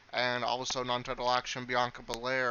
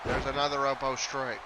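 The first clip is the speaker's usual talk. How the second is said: energetic live wrestling call, a bit louder; play-by-play commentary; 2 semitones higher, 3 dB louder than usual. Acted. Commentator calling the match for viewers, energetic play-by-play. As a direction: call the match as it happens, lifting on big moves.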